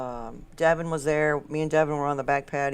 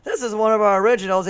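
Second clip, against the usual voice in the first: band singer's louder stage announcement; mock stage patter, pitched up; band intro announcement; 4 semitones higher, 10 dB louder than usual. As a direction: announce it to the bar like a hopeful band, higher and louder. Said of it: "it's gonna be".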